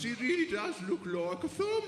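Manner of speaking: put-on high, loud Birmingham accent. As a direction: imitate an amazed audience in a high-pitched Brummie shout. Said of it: "I humbly apologise for the shit accent".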